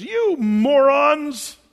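high, very loud, slow furious yell; hot-tempered Moses. Retold or imitated. Moses berating the thirsty people, furious yell. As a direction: drag out the insult in full exasperation. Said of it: "He's a little hot here".

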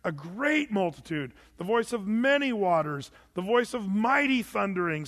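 great, many and mighty each leaned on and stretched; building phrases, punching great, many and mighty; stress on great, many, mighty. Shown as a demonstration. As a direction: swell the emphasis through great, many, mighty. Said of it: "do you see the stress here".

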